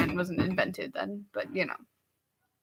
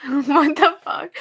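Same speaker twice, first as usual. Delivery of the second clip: laughing disbelief; 7 semitones higher, 3 dB louder, slower than usual. Real, not acted. Baffled reaction, laughing.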